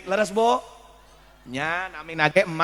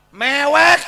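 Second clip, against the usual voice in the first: loud, high mock wail; comic loud sob word; 6 semitones higher, 11 dB louder than usual. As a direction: bawl the word out like a hammed-up cry. Preacher acts out sobbing word.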